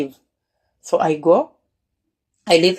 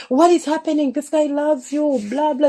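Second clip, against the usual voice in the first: much higher, disbelieving protest; mock the family's shocked disbelief, pitched up; incredulous family; 9 semitones higher than usual. Family protesting to the wife that her husband loves her, high and incredulous.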